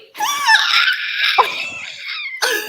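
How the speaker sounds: high shriek of laughter; overjoyed laughing cry; ecstatic laughing scream. Real, not acted. Overjoyed at Paris news.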